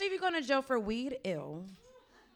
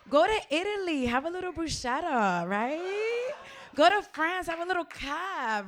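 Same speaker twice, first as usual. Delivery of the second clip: higher and louder, savoring each place; mock dreamy excitement; 6 semitones higher, 4 dB louder than usual. Comic urging the crowd toward European romance, excited. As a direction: sell the fantasy of Italy and France. Dreamy advice to travel abroad.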